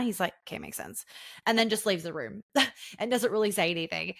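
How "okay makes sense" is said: much lower and much softer, dry makes sense; deadpan fiance. Retold or imitated. Fiance answering his partner then leaving, deadpan low. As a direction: drop it low like a shrug. Fiance quoted accepting flatly.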